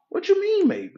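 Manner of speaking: very high, incredulous squeak; exasperated disbelief. Acted. Lost driver told maybe about the route, exasperated and high. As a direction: pitch way up in mock exasperation. Acted driver baffled by vague answer.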